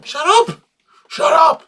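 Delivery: mock yell. Repeating the line for laughs.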